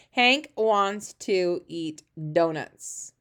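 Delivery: stretched out very slowly; slow read-along. Shown as a demonstration. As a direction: read each word slowly for the class. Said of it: "reading the sentence aloud".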